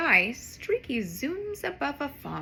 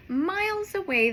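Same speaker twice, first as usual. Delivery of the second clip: ordinary delivery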